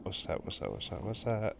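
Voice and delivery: very soft, repeated murmur; muttered to self. Real, not acted. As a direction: murmur it under your breath. Muttering to himself while searching.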